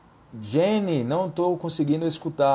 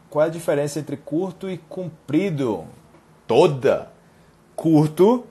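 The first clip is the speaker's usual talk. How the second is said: ordinary talk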